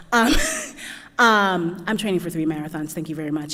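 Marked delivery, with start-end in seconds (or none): none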